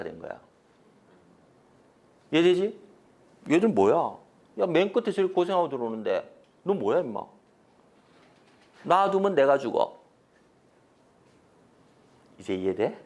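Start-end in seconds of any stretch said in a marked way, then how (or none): none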